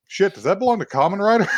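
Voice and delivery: alarmed. Retold or imitated. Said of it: "you'd just be like".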